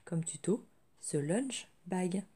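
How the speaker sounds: speaking through a sore throat; strained sore-throat voice; swollen tonsils voice. Real, not acted. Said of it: "Donc je parle un peu bizarre".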